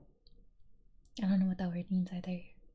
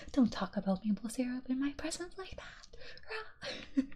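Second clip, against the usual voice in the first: mock outrage; 6 semitones higher, slower than usual. Mock outrage at maple syrup teasing.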